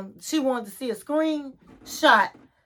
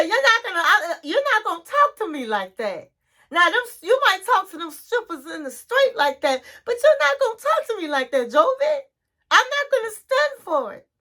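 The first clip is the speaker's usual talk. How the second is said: high-pitched mock indignation; hammed-up high, whiny defiance; indignant wife; 7 semitones higher than usual. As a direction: imitate a wife telling her husband off. Retold wife standing up to husband.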